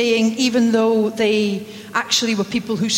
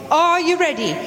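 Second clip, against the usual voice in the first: rallying countdown; 2 semitones higher, slower than usual. Real, not acted. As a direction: lively chant of go. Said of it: "countdown sending congregation to greet".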